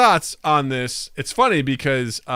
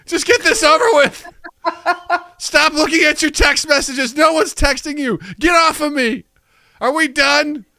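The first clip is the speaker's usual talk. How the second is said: high, loud, impatient shouting; angry toilet; 13 semitones higher, 4 dB louder than usual. Pretend. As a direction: yell at them to hurry up. Toilet scolding phone user.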